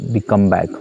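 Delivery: usual speaking voice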